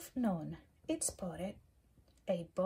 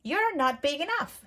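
grouchy ladybug voice; 3 semitones higher, 8 dB louder than usual. Acted. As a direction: pick a fight in a squeaky bug voice. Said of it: "said the grouchy ladybug".

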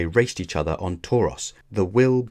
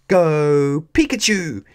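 loud, high, drawn-out shout; battle cry; 7 semitones higher, 7 dB louder, slower than usual. A narrator's reading. Ash sending Pikachu into his Z move, loud cry. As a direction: cry out the attack command. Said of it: "he cried".

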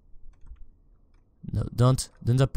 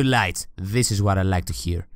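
normal talking voice

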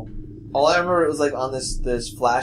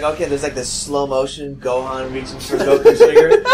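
normal talking voice

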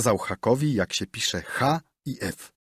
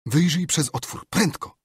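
urgent plea; 7 semitones higher than usual. A narrator's reading. Frightened boy urges his friend.

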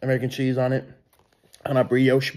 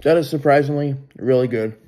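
chewing while talking; muffled by food; mouth full. Real, not acted. Seen 0: bites burger at -3; hand covers chewing mouth until 2.1.

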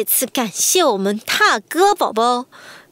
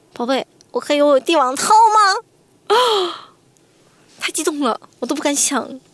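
overexcited; 3 semitones higher than usual. Real, not acted.

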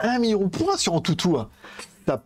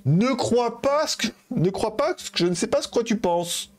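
higher-pitched, drawn-out mimicry; imitated timid assistant; 6 semitones higher than usual. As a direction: echo a coy female assistant, pitched up. (imitation aiguë de l'assistante timide)

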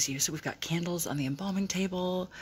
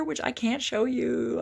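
higher, louder and slow, playful; loud teasing; 7 semitones higher, 6 dB louder, slower than usual. Real, not acted. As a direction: tease it out big and slow. Teasing a hidden birthday gift.

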